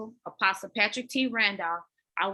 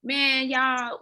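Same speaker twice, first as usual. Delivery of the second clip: ordinary talk, nothing marked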